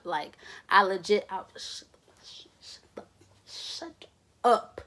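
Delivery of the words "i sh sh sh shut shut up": whispered shut up, barely voiced; urgent whisper. Telling his drunk friend to hush in front of the officer, in a whisper. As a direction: hiss it so the cop can't hear.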